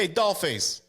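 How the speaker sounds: lightly voiced tough guy. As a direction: call her over like a hammed-up wise guy. Voicing the man calling his woman.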